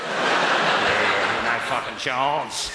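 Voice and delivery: slow, drawn-out dismissal; a hint of a scornful goalkeeper, slowed down; mock scornful refusal. Acts the goalkeeper's scornful reply.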